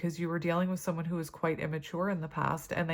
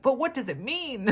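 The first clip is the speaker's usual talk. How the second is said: high, lilting, sing-song question; Jack from Nightmare Before Christmas; 7 semitones higher, 3 dB louder than usual. Retold or imitated. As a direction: ham up a theatrical cartoon puzzler, high and swooping. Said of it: "I'm like Jack in Nightmare Before Christmas".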